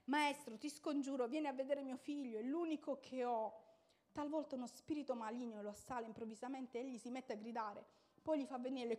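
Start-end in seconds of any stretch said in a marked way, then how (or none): 0.0-7.0 a father begging the master, higher and louder; pleading cry. A narrator's reading. Father begs Jesus for his son: pleading louder cry. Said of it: "un uomo si mise a gridare".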